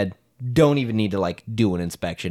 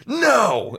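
shouted warning; 14 semitones higher, 5 dB louder than usual. Retold or imitated. Whole household yelling to stop him.

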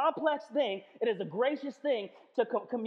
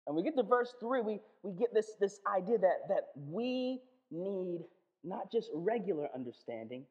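usual speaking voice

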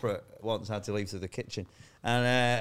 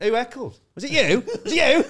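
loud, higher mock-menacing call to the crowd; 12 semitones higher, 9 dB louder than usual. Comic retelling of hunting the heckler in the audience, mock-loud challenge. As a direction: bellow it at the crowd like a man waving chairs.

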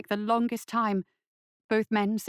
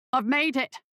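higher, triumphant call; 4 semitones higher than usual. A narrator's reading. Weber reaching the chest, calling out to Lackman. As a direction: call it out across the clearing. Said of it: "he called out".

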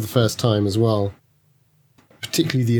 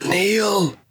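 calling out; 9 semitones higher than usual. Acted. Calling out for missing friend.

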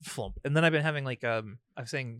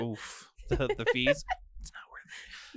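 whispered aside. Retold or imitated. Retold reaction to fees.

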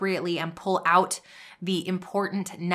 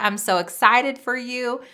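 ordinary talk

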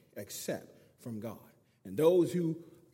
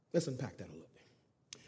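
usual speaking voice

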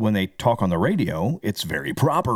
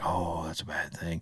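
hushed disapproval; 5 semitones lower, 11 dB quieter than usual. Retold or imitated. Imagined old-time disapproving reaction.

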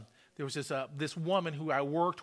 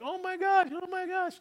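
mock yelling; 11 semitones higher than usual. Healed woman going around amazed, mild mock yelling. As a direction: exclaim it high, like an astonished woman. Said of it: "she started yelling".

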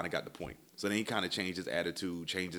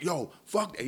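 high, raised mimicked outburst; retold yelling; 10 semitones higher, 4 dB louder than usual. Tow guy loses patience on phone, mock yell. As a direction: imitate an angry man cutting in. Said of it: "dude was yell".

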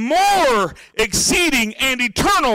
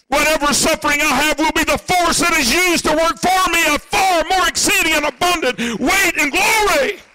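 full-force preaching; 6 semitones higher than usual. Acted. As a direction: drive it home to the congregation. Sermon climax.

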